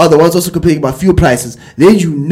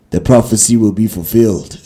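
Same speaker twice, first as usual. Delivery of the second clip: dramatic monster prophecy; 7 semitones lower than usual. Retold or imitated. Retelling a monster scene to listeners, low ominous proclaiming. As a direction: intone it like a monster's ominous decree. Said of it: "saying something dramatic like".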